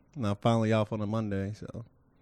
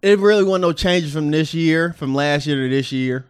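louder voice; 4 semitones higher, 9 dB louder than usual. Pretend. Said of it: "Use my sexy voice".

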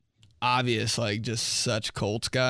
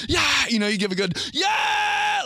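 cheering; 9 semitones higher than usual. Shown as a demonstration. Demonstrating a cheer.

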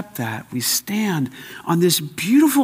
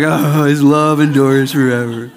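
loud, drawn-out mimicked chorus; mock obvious answer; 7 dB louder than usual. Preacher mimicking his congregation's answer to "any guesses", mock chanting. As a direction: imitate a crowd reciting the obvious, slow and loud.

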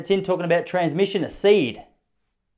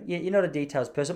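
normal talking voice

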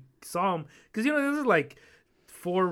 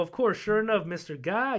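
ordinary talk

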